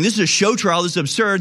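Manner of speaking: imitating an indignant protest, pitched high; mock-angry courtroom outburst; getting mad. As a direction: act out someone getting worked up. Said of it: "was getting mad and going".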